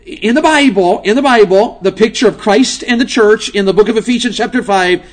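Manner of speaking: fiery pulpit delivery; preaching at full force. Acted. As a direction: build it up again.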